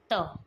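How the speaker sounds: त as a short, unaspirated "tuh"; changed final sound. Shown as a demonstration. Teacher showing how the final sound changes, sound demonstration. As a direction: say त alone, a brief dental t. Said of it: "final sound होता है change होकर".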